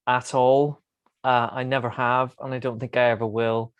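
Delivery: ordinary delivery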